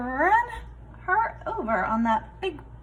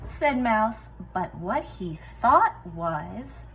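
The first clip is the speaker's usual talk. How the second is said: usual speaking voice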